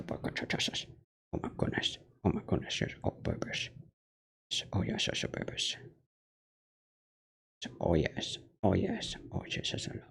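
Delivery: soft whisper to a pet; whispered cooing. Real, not acted. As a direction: coo to the cat under your breath. Cooing to the cat on her lap.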